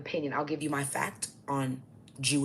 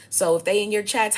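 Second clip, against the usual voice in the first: louder and higher, building into a shout; shouting at viewers; 6 semitones higher, 7 dB louder than usual. Real, not acted. Ranting to her viewers about strangers in chat, loud heated voice. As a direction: raise the voice, heated warning.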